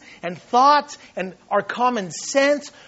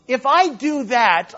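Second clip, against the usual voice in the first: ordinary delivery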